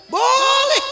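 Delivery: high, loud shouted yes; mock shout. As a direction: bellow the permission.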